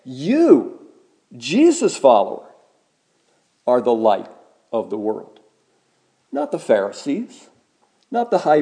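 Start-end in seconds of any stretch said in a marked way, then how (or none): 0.0-5.4 "You" hit hard, then the phrase falls away; stress on you. Shown as a demonstration. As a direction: lean on "You" and let the rest drop. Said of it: "says the word "you" here is emphatic".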